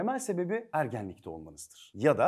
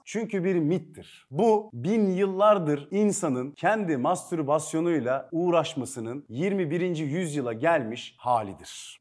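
normal talking voice

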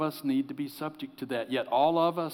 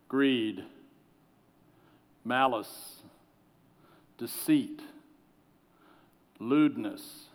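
slow solemn reading; 4 semitones lower than usual. Shown as a demonstration. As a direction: let each sin hang in the silence. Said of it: "I'm gonna read 'em slowly".

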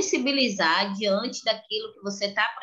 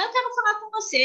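put-on high, firm little girl; a small girl demanding attention; child voice; 11 semitones higher, faster than usual. Daughter confronting the driver, high and firm.

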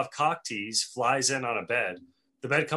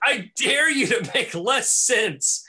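high, loud, slow dramatic challenge; hammed-up indignant dare, stretched out; Dean; 9 semitones higher, 6 dB louder, slower than usual. Dean answering Hank's nonsense, indignant challenge. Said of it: "and then Dean says".

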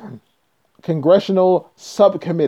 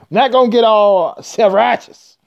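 ordinary talk, nothing marked

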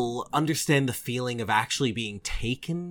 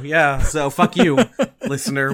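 laughing; 3 semitones higher, 6 dB louder than usual. Real, not acted. Teasing insult to listener.

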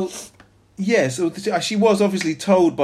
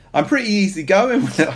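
put-on Irish accent; 7 semitones higher than usual. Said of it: "I can't do an Irish either".

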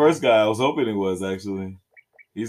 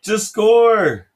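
higher, louder plea; yell at TV; 10 semitones higher, 8 dB louder than usual. Real, not acted. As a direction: shout it at the screen. Yelling at the TV.

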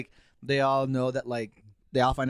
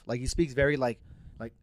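ordinary talk

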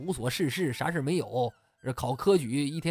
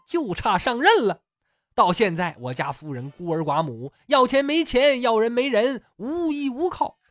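loud, higher, insistent sales pitch; higher, louder old-secretary pitch; persuading secretary; 5 semitones higher, 5 dB louder than usual. Acted. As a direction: talk him into it, pushing every point. Secretary persuades scholar to marry widow.

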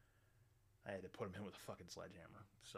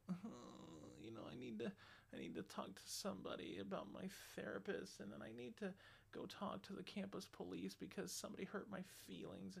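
mocking whiny guy; 9 semitones higher than usual. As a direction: sneer at him with a put-on whimper. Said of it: "Don't just sit there and just go".

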